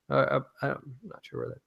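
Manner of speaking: ordinary talk, nothing marked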